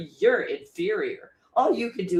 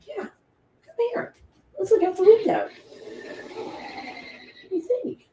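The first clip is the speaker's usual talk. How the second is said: high dog-talk coo. Real, not acted. Inviting her dog to look out the window, in a high coo.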